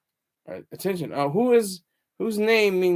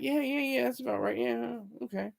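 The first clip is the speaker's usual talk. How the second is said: higher, softer mock agreement; offhand agreement; 5 semitones higher, 9 dB quieter than usual. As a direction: go along casually, under your breath. Acts out unquestioning, casual agreement.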